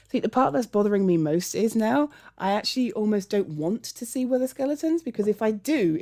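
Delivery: ordinary talk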